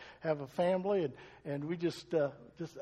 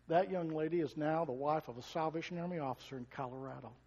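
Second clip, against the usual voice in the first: normal talking voice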